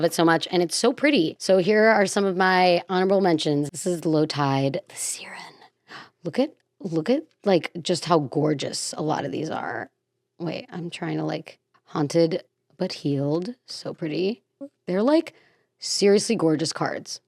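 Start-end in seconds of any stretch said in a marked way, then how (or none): 3.7-14.4 hushed awe. Real, not acted. Hushed awe over pretty cards.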